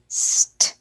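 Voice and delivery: unvoiced St blend; sounding out. Shown as a demonstration. Teacher demonstrating the blend St, whispered. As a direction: hiss out St like a child.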